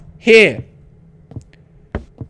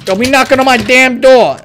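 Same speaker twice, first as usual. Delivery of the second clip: raised, higher shout at the knocker; loud mock-angry warning; indignant yell; 4 semitones higher, 5 dB louder than usual. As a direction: yell through the door, annoyed. Yelling at whoever keeps knocking.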